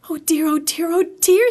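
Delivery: high, fretful little Piglet voice; worried Piglet. A narrator's reading. Piglet nears the Heffalump trap, worried. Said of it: "said Piglet to himself".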